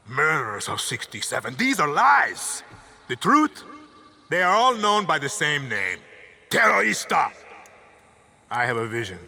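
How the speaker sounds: dictator rallying the nation, building from whisper to shout; rousing political speech. Acted. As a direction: orate like a tyrant swaying a crowd.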